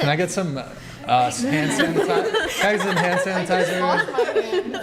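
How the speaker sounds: high, laughing request. Real, not acted. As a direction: ask through giggles. Laughing asks for sanitizer.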